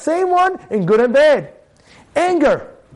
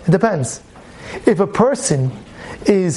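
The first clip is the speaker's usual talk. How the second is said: normal talking voice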